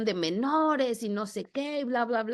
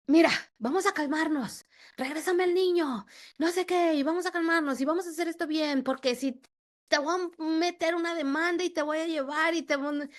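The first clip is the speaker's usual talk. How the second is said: higher pleading then threatening ex-partner; mock his voice, pitched up, coaxing into threats; quoting the ex's texts in a raised voice; imitating her ex; 7 semitones higher than usual. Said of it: "me mandaba mensajes de".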